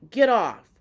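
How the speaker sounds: punchy mock order, thrown out loud; retold stern order. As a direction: imitate a driver ordering someone out. Driver orders man off, retold.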